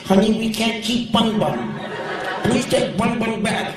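high, sing-song lady voice; crazy lady voice. Retold or imitated. Comic crazy woman soothing her kids at the counter. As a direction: coo at the kids like the nutty owner.

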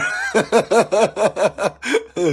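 a delighted laugh; laughter. Real, not acted. Spotting Danny watching the live video, laughing. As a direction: laugh at the mate you spotted.

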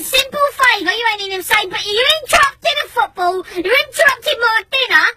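high, heated scolding; indignant retort. Retold or imitated. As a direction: echo his words in outrage, then list the offences.

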